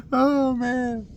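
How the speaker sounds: high-pitched laugh into oh man; cracking up mid-sentence; giggling oh man. Real, not acted. Laughing at his own joke.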